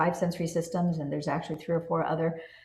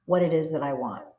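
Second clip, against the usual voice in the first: normal talking voice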